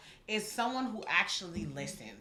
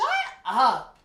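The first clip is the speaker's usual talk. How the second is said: mock snap; 3 semitones higher, 10 dB louder than usual. Retold annoyance at the guy.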